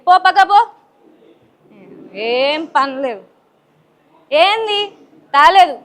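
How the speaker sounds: loud, furious fatherly yelling; angry shout. Acted. Father yelling at daughter over chocolates, angry shout. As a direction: bark him out of the room.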